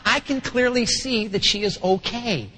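usual speaking voice

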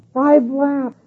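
imitating an angry instructor's pitch jump. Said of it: "his voice went up a few pitches".

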